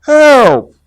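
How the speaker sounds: high, loud panicked scream; loud panicked rabbit scream; scream for help. Acted. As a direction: shriek for help. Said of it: "Rabbit screamed".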